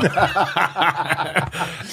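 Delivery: real laugh breaking out high; bright real laughter; high burst of laughter. Real, not acted. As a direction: laugh in delight. Laughing at client's surprise.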